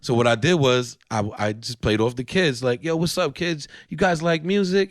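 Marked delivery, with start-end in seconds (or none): 2.8-4.9 lightly put-on, higher-pitched kids' entertainer; a hint of cheery Disney host, pitched up for children. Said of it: "put my Disney voice on".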